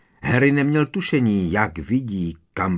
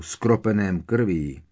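ordinary delivery, nothing marked

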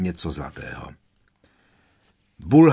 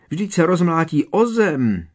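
higher, alarmed shriek; 7 semitones higher, 4 dB louder than usual. A narrator's reading. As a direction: cry out in fright. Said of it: "vřískla Hermiona". (vyděšený výkřik)

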